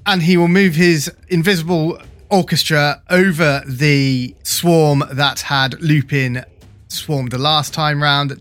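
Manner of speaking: usual speaking voice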